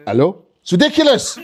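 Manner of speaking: hammed-up suspicious French caller; paranoid French accent. French person answering expected call, paranoid French accent. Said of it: "sound paranoid whenever they answer the phone".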